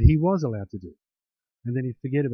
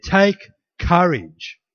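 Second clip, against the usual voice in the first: emphatic command; 2 semitones higher, 4 dB louder, much slower than usual. Shown as a demonstration. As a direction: proclaim it boldly. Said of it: "Jesus said".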